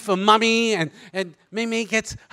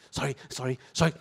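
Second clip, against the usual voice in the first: hushed apology; 9 semitones lower, 9 dB quieter, faster than usual. Acted. Squeezing past seats in cinema.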